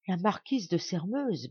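ordinary delivery

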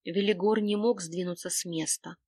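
plain reading voice

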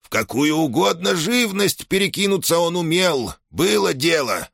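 put-on high, rapid proud old man; chattering old shaman. A narrator's reading. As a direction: rattle it off with pride. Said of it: "затараторил престарелый кудесник".

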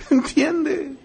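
laughing through the question; amused laughing question. Real, not acted. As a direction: ask it while chuckling. Laughs at own point.

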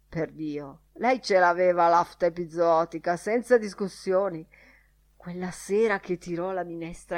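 loud, emphatic, blustering character voice; ranting Crawford. Acted. Crawford rants about the wife.

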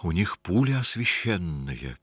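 plain reading voice

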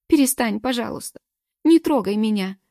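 put-on high, loud crying plea like a child; crying plaintively. A narrator's reading. Galka pleading with Morgen, crying like a child. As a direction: sob it out, begging like a child. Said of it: "заплакала она жалобно, как ребёнок".

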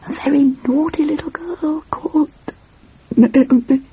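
high, teasing story voice; playful storytelling. Acted. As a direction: draw out the tease for a little sister. Resumes whispered tale, teasing little sister.

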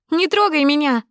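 final scream of «Не трогай меня!», hitting трогай; shrill final «Не трогай меня!» at her father; repeated angry shout. Acted.

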